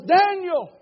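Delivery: loud, high anguished cry of the name; a king calling into the lions' den. Acted. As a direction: call out to him in anguish. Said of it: "in an anguished voice".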